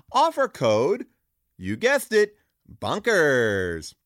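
upbeat sponsor read, punchy and salesy. Acted. Host pitching Squarespace to listeners, energetic ad read. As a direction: sell it like a radio spot.

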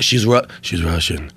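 Seagal impression. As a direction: drop low and drag it out like a tough guy. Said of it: "And Seagal's like".

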